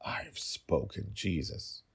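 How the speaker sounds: soft, slow whisper; whispered aside. Acted. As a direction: mutter it so only one listener hears. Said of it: "In a voice only he and I could hear".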